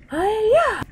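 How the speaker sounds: high, loud battle cry. Acted. Hero teleports them away with a high, loud yell.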